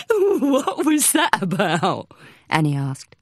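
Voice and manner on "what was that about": high and laughing through the words; giggling. Acted. Annie asking Sharon, giggling after the call. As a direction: ask while still giggling. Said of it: "there was an explosion of giggles".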